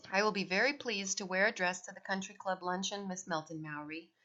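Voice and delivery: ordinary delivery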